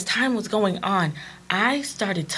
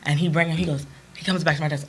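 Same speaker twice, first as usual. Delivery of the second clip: ordinary talk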